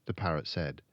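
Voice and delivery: usual speaking voice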